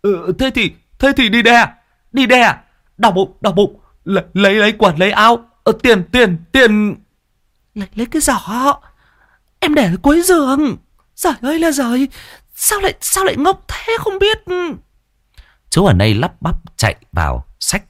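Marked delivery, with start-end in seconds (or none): 0.0-6.9 high, flustered stuttering husband; husband panicking as his wife goes into labour; panicked stammering. Acted. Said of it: "mồm cứ lắp ba lắp bắp". 7.7-14.4 very high, scolding wife's voice; wife scolding her clumsy husband; exasperated wife. Acted.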